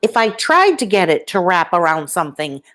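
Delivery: ordinary delivery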